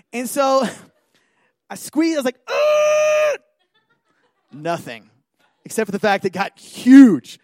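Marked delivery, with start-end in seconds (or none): 2.5-3.4 high, loud strained cry; mock squeal of painful effort; straining squeal. Acting out squeezing the pimple.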